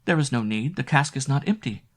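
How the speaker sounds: a touch of nervous pleading; nervous plea. A narrator's reading. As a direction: try to talk her out of it. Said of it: "stammered Annette".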